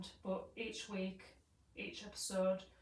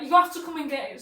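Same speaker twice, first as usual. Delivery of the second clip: loud, higher mimicked order; acted urgent demand; 5 semitones higher, 16 dB louder than usual. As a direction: insist at a shout.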